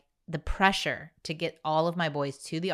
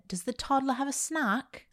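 put-on a higher, kindly British accent; 9 semitones higher than usual. Mentor asking about the toddler's snack, British accent. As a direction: ask gently in a British accent. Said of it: "she had a British accent".

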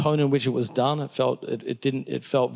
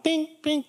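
two tiny high-pitched bings; ping out little products like a machine; high "bing, bing" sound effect; imitated machine ping. Imitates the factory's tiny output.

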